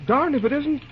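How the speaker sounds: high, excited disbelief; surprised recognition. Acted. Bill spots his famous uncle on the platform, excited surprise. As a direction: pitch up as it dawns on him.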